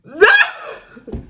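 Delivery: high burst of real laughter; sudden high squeal of a laugh. Real, not acted. Cracking up at the viewer's absurd story, a high laughing burst. Reacting to the brother-in-law's line.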